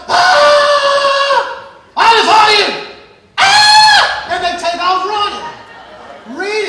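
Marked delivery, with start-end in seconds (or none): 0.1-1.7 high, loud battle scream; army shouting. Acted. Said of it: "they're over here shouting". 2.0-2.7 loud, high giant's taunt; booming challenge. Acted. Goliath's challenge. 3.4-4.3 very high, loud battle scream; short full-out charge yell; army shouting. Acted. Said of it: "they're over here shouting".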